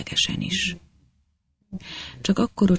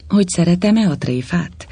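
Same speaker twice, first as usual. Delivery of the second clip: booming; 6 semitones higher, 4 dB louder than usual. A narrator's reading. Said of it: "harsogta még egyre az elnök".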